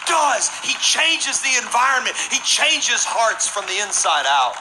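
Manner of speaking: high, stretched-out pulpit shout; fervent preacher at a climax; full-force preaching. Real, not acted. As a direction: proclaim it slowly and forcefully to the crowd.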